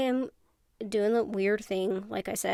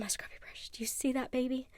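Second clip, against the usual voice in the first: excited little whisper to the viewers; 3 semitones higher, 4 dB quieter than usual. Real, not acted. Whispering to viewers about her scrubby brush. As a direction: hush it like a secret thrill.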